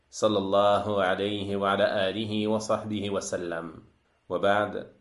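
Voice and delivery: brisk recited sermon opening; formal Arabic opening. Acted. Teacher opening a lesson for his listeners, fast recited Arabic praise. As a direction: rattle off the opening praise like a practised preacher.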